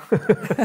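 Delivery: bright burst of laughter, high and loud; a short amused laugh at the guest. Real, not acted. Host laughing at the guest's unsure question.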